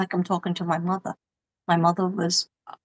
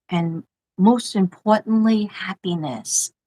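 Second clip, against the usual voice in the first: slowed down, weighing each word of the card's closing; slow dramatic reading; 3 dB louder than usual. Real, not acted. Reading the card's closing to viewers, slow and weighty. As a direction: draw out the closing words for effect.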